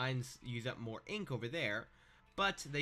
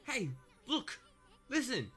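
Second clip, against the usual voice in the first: squeaky character voice; 11 semitones higher, 4 dB louder than usual. Acted. Voicing Issun's callouts.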